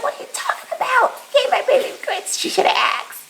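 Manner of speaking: a high, squeaky mock-outraged mom at her husband; squeaky mock outrage. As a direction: squeal the complaint in a high, fast voice. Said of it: "I'm like".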